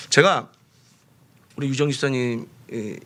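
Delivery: usual speaking voice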